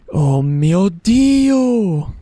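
slow, drawn-out shocked cry; dragged-out horrified oh my God; shocked exclamation. Real, not acted. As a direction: stretch out the shock. Sudden enemy appears.

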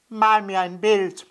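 put-on high, stubborn child's demand; insistent child. A narrator's reading. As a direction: repeat it like a nagging little boy. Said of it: "der kleine blonde Junge". (hohe, quengelnde Kinderstimme)

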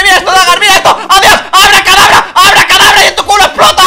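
high, loud hammed-up incantation; mock spell shout. Showing off a prank to Dagar with a shouted spell, hammed-up. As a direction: yell the magic words like a showman.

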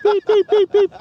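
high beeping alarm imitation; alarm beeps. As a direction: beep like a timer going off.

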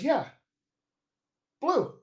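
a confused brain that hears no difference; student's brain shrugging. Retold or imitated. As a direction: toss it off high and careless. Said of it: "the student's brain goes".

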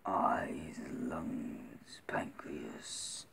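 soft, whispered monster voice; Venom. Shown as a demonstration. Man practising a film villain's line, whispered monster impression. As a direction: whisper it like a creature sizing up organs. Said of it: "I got his voice down".

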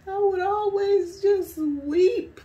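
high, loud re-enacted wail; recalled weeping. Recalling remorse after committing to Christ, wailing re-enactment. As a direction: pitch it way up, loud, as if overcome.

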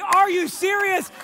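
mock outrage at the ad break; exasperated disbelief. Viewer groaning at the commercial break, high and exasperated. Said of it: "You're like".

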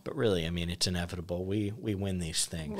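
low, soft acted assurance; quietly confident. Imagined wartime voice assuring British people of victory, quietly confident. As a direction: drop the voice and confide it calmly.

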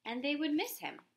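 put-on mother explaining to the children. A narrator's reading. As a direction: explain it kindly, up high. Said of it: "explained the mother".

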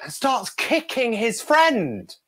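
plain reading voice

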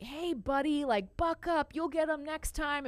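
high, loud hammed-up buck-up coach voice; jolly it along like a chummy cheerleader; mock pep talk. Imitating a boss giving a hollow pep talk to a rejected actor, high and loud and hammed-up. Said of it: "It wasn't that energy".

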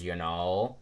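ordinary talk

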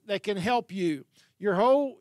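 usual speaking voice

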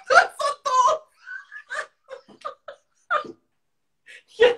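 high teary laugh. Real, not acted. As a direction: laugh until almost crying. Lacht weinerlich. (lachend, fast weinend)

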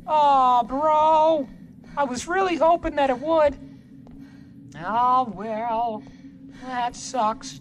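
high, whining letdown; high, complaining whine about the dead lights; whiny disappointment. Acted.

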